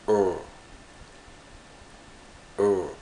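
lone French vowel drill on "E", said firmly; attempted French vowel. Shown as a demonstration. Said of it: "La prononciation de E A U est difficile aussi".

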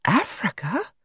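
high, blankly puzzled question; stunned, blank repeat; blank echo. A narrator's reading. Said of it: "Maisie echoed the word blankly".

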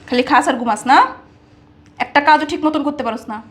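scolding. Acted. Berating the servant as useless.

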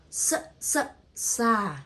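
two short "s" sounds, then "sa" held long; sounding out SA. Shown as a demonstration. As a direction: build "sa" sound by sound. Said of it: "And the next syllable".

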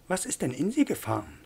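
high-pitched, puzzled question; swooping, incredulous character voice; taken aback. Acted. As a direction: ask in acted astonishment. Abbé reacts to the outburst.

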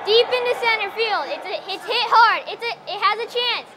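loud, rising play-by-play shout; excited home run call. Pretend. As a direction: call the big hit like a sportscaster. Home run call, crowd wild.

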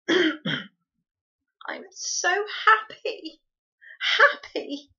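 high, breath-filled tearful joy; gasping with happiness. A narrator's reading. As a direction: gasp it out, overcome with happiness. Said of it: "she gasped".

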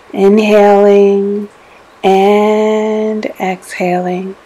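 slow, drawn-out words held to a breath; slow breath pacing. Acted. Meditation guide pacing listeners' breathing in and out, slow and drawn out. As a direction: stretch each cue to match a long breath.

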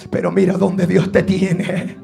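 soft, low and tender delivery; hushed preaching. Real, not acted. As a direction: drop the sermon to a low hush. Hushed first take before repeat. (prédica en voz baja y grave)

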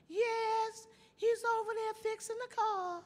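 very high falsetto lady voice. Retold or imitated. Lady at the door telling Paul Revere, very high falsetto. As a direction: answer in a high squeaky lady voice.

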